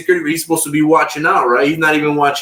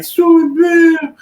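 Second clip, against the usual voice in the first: mocking snitch voice; 5 dB louder, much slower than usual. Cellmate names him to cop.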